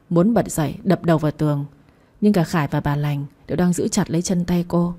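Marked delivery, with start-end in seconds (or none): none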